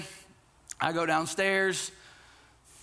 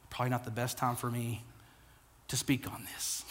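soft honest admission; 5 semitones lower, 8 dB quieter than usual. Pretend. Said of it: "It's okay to say".

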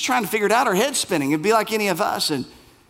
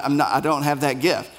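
usual speaking voice

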